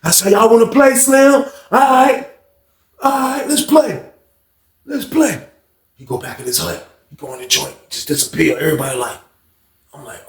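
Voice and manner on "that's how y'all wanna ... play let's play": high, agitated threat; menacing challenge. Retold or imitated. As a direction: accept the challenge, worked up. Acts out victim's threat.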